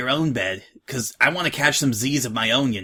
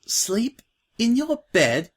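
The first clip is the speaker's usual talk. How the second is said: higher, slow surprised question; lightly voiced timid filly; 9 semitones higher, slower than usual. New girl to Scratch, a hint of timid surprise.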